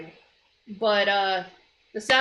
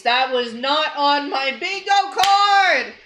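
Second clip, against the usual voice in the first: loud, high, slowed disbelieving shout; shocked outburst; 6 semitones higher, 6 dB louder than usual. Real, not acted. Gamer seeing conjoined twins, loud shocked shout. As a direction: yell it in total disbelief.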